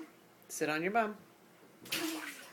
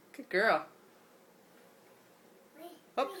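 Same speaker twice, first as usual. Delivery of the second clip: usual speaking voice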